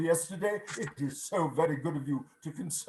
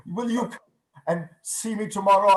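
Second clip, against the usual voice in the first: usual speaking voice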